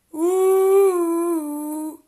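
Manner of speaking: a loud ooh far above the voice; loud, high jeering hoot. Real, not acted. Mocking exclamation.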